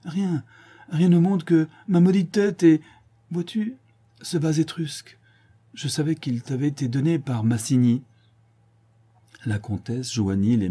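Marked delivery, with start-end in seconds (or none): 0.1-8.0 high, strained voice, loud in bursts; distraught confession. A narrator's reading. Sinclair admitting his jealousy to Mathilde, high distraught voice. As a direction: confess in a breaking rush.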